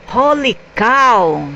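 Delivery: put-on wowed boy's slow exclamation; amazed exclamation. A narrator's reading. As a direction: marvel slowly, pitch lifted. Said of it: "Matthew thought to himself".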